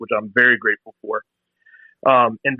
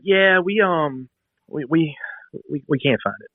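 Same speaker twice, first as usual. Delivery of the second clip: mock sheepish, halting phone reply; stammering company rep; 3 semitones higher, slower than usual. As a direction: imitate a rep faltering and restarting. Company rep's stammering answer, retold.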